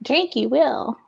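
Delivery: Yoda voice. Pretend. Baby Yoda joke.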